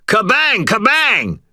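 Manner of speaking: high, loud screamed spell word; screamed incantation. A narrator's reading. Wizard screaming a spell at the liquor bottles. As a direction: hurl the word like a blast. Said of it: "gathered up my will and screamed".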